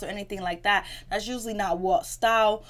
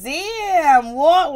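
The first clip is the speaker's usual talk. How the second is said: high, loud, disbelieving exclamation; loud mock astonishment; imitated shock; 7 semitones higher, 8 dB louder than usual. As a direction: exclaim it as a stunned onlooker. Retelling Tatiana's shocked reaction.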